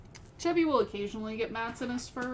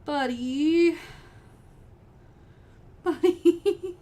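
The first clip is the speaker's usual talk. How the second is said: tearful goodbye; 6 semitones higher, 7 dB louder, much slower than usual. Real, not acted. Calling cat emotionally.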